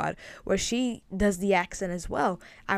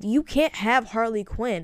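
usual speaking voice

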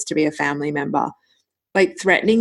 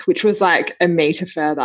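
ordinary talk, nothing marked

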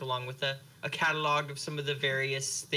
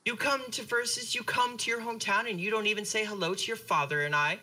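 higher, indignant motherly scolding; guilt-tripping mom; 5 semitones higher than usual. Acted. Mother scolding her son for skipping a visit, indignant. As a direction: lay the guilt on thick, wounded mother.

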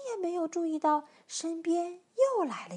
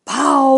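very loud, drawn-out "paooo"; one huge bellowed blast; elephant's scare blast; 18 dB louder than usual. Acted. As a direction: trumpet it like an elephant. Elephant blasts after deep breath.

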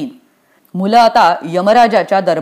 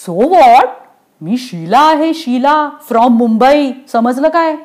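high-pitched cheeky comeback; bratty, higher-voiced girl; sassy defiance; 5 semitones higher, slower than usual. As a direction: answer back with swagger. Sheela talks back to Yamraj.

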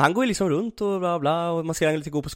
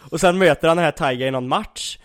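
normal talking voice

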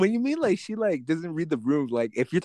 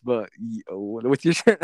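ordinary delivery

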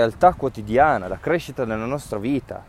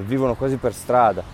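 normal talking voice

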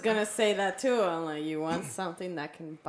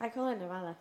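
Mexican accent; 5 dB quieter than usual. Shown as a demonstration. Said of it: "Just with the Mexican accent".

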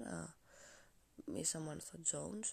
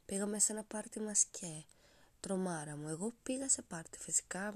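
normal talking voice

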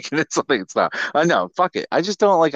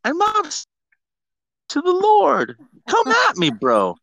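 mock defiant shout; slower than usual. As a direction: yell it up at the heavens in jest.

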